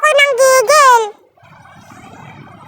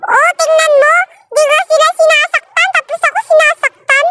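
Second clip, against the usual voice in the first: indignant complaint; 6 semitones higher than usual. Real, not acted. Complaining about players hitting her.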